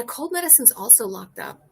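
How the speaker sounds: normal talking voice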